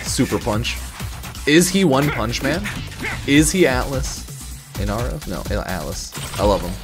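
ordinary talk

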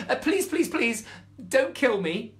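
high, loud begging mouse; desperate pleading. Acted. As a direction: plead for your life. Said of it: "more desperate than ever".

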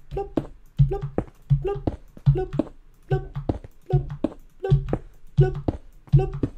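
playful mouth plop noises; plop sound effects. Pretend. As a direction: mouth plops, one after another. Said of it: "Do you like my sound effects?".

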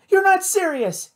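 high, sharp protest; cried in disbelief. A narrator's reading. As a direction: Kate protests getting rid of the creature, high disbelief. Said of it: "Kate cried".

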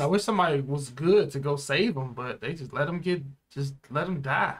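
usual speaking voice

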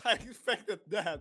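nervous laugh through words; shaky relieved laughter. Real, not acted. Laughing after scare.

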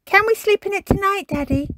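put-on high, fast child's question; Peppa, eager request. A narrator's reading. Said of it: "asked Peppa".

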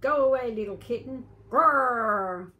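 loud growly big dog; menacing dog sending kitten off; growling dog. Acted. Grandma reading, dog chases kitten, loud growling voice. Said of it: "said a big dog".

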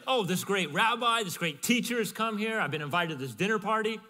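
loud, high gushing over a famous guest; mock gushing. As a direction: play the starstruck host. Mocking a shallow view.

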